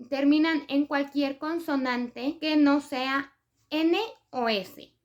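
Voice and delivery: ordinary delivery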